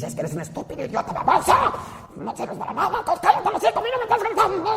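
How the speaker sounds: very high, fast screeching insults; mock furious rant. Preacher mimics an enraged spouse screaming at their partner. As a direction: shriek insults at your spouse, mockingly.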